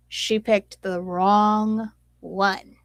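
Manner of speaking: slow, deliberate warning; slow and menacing. Real, not acted. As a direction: lean into it slowly, threatening.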